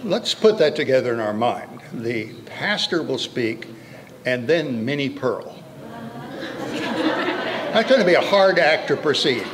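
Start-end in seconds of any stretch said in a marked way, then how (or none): none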